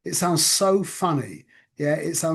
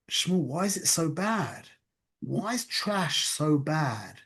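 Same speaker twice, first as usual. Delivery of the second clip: soft, probing inner question; voice in the head; 5 dB quieter, slower than usual. Retold or imitated. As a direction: gently question yourself, slowing at the end. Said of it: "this voice saying to me".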